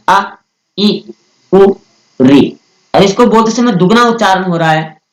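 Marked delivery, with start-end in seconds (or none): 0.1-2.6 each vowel clipped quick and brief; short vowels. Shown as a demonstration. Teacher demonstrating short vowels to students, brief and quick. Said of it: "कम समय लगता है".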